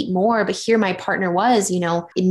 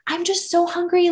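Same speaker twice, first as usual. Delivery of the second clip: whiny hungry; 10 semitones higher than usual. Retold or imitated. Whining to partner about hunger.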